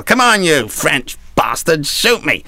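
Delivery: high, drawn-out battle taunt; defiant taunt. Retold or imitated. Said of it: "he'd stand there and he'd go".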